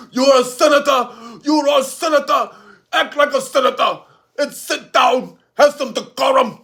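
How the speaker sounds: stern, loud mock reprimand; scolding chairman. Bernie Sanders scolding Mullen to sit down, mock stern. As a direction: lecture him like an old chair. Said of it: "Then Bernie Sanders".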